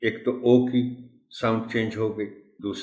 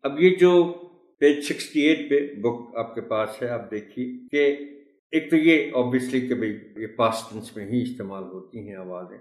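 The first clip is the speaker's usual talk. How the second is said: ordinary talk, nothing marked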